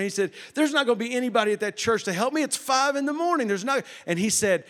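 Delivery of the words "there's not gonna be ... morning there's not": high-pitched retold protest; talking back. Man arguing with the voice in his car, incredulous protest. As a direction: argue back in rising disbelief, pitch climbing. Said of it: "I was talking back to this voice".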